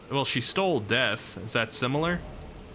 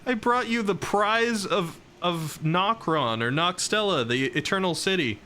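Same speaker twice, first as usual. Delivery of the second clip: exasperated protest; 8 semitones higher than usual. Retold or imitated. Streamer reenacting her argument with Ronnie, high exasperated pleading. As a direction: plead your case, pitched up and baffled. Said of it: "And I'm like".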